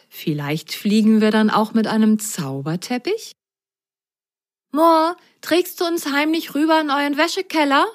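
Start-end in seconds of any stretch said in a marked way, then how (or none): 4.7-7.9 higher, pleading voice; asking the cat. Acted. Grasgrün begs the cat, higher and pleading. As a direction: ask the cat nicely. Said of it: "bat er den Kater".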